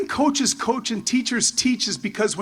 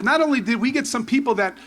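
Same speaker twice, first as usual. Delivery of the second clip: usual speaking voice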